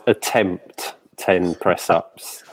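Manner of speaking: "attempt ten press-ups" said slowly, leaning hard on "attempt"; slow, pointed stress on "attempt"; emphasis on attempt. Shown as a demonstration. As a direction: drag out "attempt" to tease about the press-ups. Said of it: "I emphasize".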